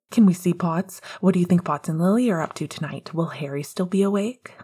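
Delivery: usual speaking voice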